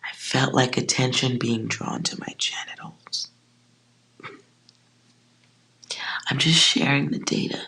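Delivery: sheepish whisper; whispered confession. Real, not acted. Reader admitting an odd feeling, in a whisper. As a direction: whisper it like an awkward secret.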